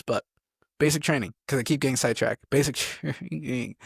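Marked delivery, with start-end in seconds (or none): 2.5-3.8 slow, broken by a laugh. Real, not acted. Speaker catches own sidetracking, laughing through it. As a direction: laugh through the middle of the words.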